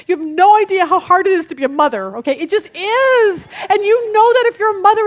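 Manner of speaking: a mother's heartache spilling over loudly; exasperated outcry. Retold or imitated. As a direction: let it burst out, half laughing, half crying. Retelling her tearful outburst to son.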